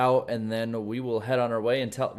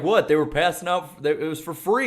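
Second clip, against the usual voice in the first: high, loud mock innocent excuse; 6 semitones higher, 8 dB louder than usual. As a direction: play dumb, loudly innocent. Mock stoned driver excuse.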